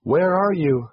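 high-pitched, mildly urgent question; urgent calling out. A narrator's reading. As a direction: call out for someone, a bit urgently. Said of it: "she called out a bit urgently".

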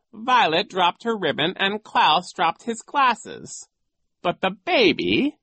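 high, loud storytelling villain; scheming coach making his report; sly villain coach. Acted. Voices Genghis telling his story.

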